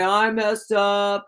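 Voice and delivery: slow, drawn-out sorry ex; sheepish ex. Retold or imitated. Reader imitating the ex's late-night drunk dial, slow and sheepish. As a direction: drag it out like a guilty drunk caller. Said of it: "and he's gonna say".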